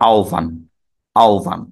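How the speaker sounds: আহ্বান said as aovan, the h and b becoming o and v; teacher models আহ্বান as aovan; correct pronunciation. Shown as a demonstration. Teacher demonstrating the right sound of আহ্বান to students. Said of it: "তাহলে এটির উচ্চারণ হলো".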